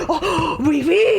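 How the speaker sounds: high, gasping mock delight; gasping boyfriend. Friend mocking the boyfriend's thrilled French reply. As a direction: gasp and gush the French yes. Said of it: "he was like".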